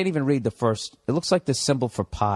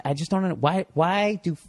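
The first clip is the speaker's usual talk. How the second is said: ordinary talk, nothing marked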